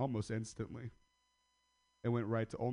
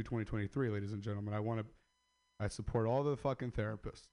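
normal talking voice

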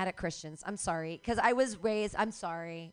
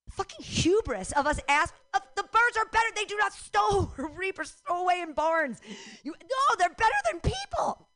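much higher, loud outraged squeal; outraged rant; 9 semitones higher, 6 dB louder than usual. Real, not acted. Comic ranting that birds beat people, high and loud. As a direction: go up into indignant shrieking.

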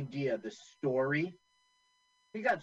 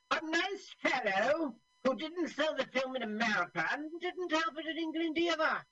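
high-pitched snooty put-on quote; mock snotty; 13 semitones higher than usual. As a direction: sneer it in a hammed-up high voice. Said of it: "the director says some snotty thing about him".

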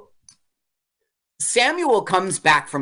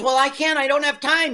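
whiny excuse; 11 semitones higher than usual. Retold or imitated. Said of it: "He goes".

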